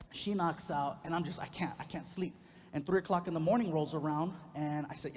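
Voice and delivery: usual speaking voice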